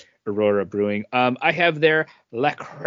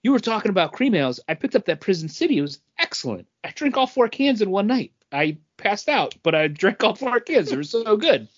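excited coworker; 7 semitones higher than usual. Retold or imitated. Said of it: "one of the guys at work was like".

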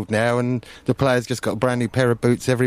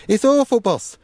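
whiny goalkeeper; 11 semitones higher than usual. Retold or imitated. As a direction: whinge like a put-upon keeper. Goalkeeper voiced complaining to chairman.

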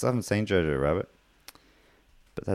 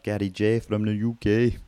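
higher mock Brummy sign-off; Brummy accent; 4 semitones higher than usual. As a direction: echo the name in put-on Brummy. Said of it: "his great Brummy accent there".

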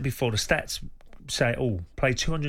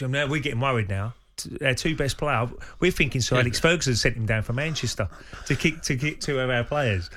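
ordinary talk, nothing marked

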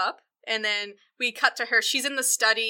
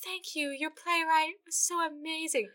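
higher, much softer, drawn-out gushing; lady rehearsing her lines; 6 semitones higher, 8 dB quieter than usual. Retold or imitated. Lady rehearsing praise for a playwright into a mirror, high soft gushing. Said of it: "reenacting their conversation where she's like".

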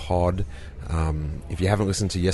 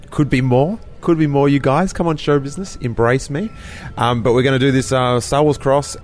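louder and higher, mock-begging; hammed-up showbiz plea; 7 semitones higher, 6 dB louder than usual. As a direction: playfully beg show business for more. Mock plea to show business.

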